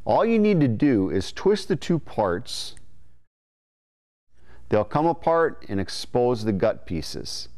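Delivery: ordinary talk, nothing marked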